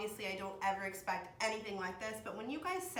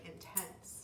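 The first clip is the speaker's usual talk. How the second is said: hushed whisper; 4 semitones lower, 11 dB quieter than usual. Real, not acted. Opening gift, voice drops.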